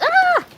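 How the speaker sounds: sudden high squeal; startled shriek. Real, not acted. Startled by the dog mid-sentence, shrieking. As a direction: yelp in surprise. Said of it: "drowned by the dog".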